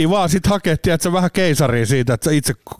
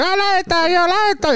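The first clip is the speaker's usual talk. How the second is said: put-on baby whining. Baby in the car wants to leave, high whine. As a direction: whimper like a tiny baby wanting to go. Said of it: "kun pikku vauva".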